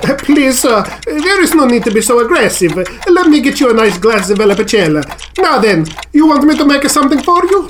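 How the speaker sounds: put-on polite Italian-accented old craftsman; placating Italian woodcarver. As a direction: soothe an aggressive customer with an Italian accent. Voices the woodcarver.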